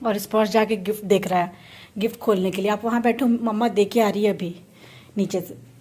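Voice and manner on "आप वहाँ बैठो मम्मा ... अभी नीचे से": normal talking voice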